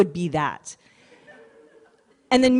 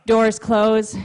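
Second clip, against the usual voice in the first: ordinary talk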